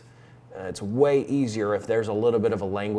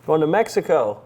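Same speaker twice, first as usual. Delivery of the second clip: raised, higher phone voice; loud phone voice; 7 semitones higher, 8 dB louder than usual. Real, not acted. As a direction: project up for a weak line.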